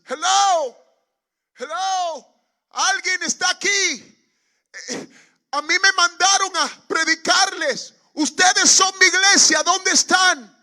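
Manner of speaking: high, full-force shout across an open field. Retold or imitated. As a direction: call out loudly to a congregation nobody can see. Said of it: "empecé a gritarles".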